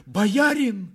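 high, forceful outcry; high-pitched impassioned call; indignant cry. A narrator's reading. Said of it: "вскричала она".

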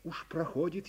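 plain reading voice

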